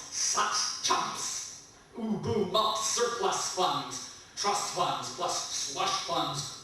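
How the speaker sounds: punchy, rhythmic recital of short phrases; poetry reading. Acted. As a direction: spit out each phrase with glee. Reads poem to audience.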